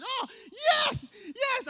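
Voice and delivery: high, loud victory shout; excited cheer. Retold or imitated. Fan cheering the comeback, loud and high. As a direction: yell it out in jubilant relief. Retold celebration at game's end.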